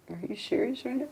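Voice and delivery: a breathless, incredulous aside; shocked disbelief. Retold or imitated. Shocked inner reaction.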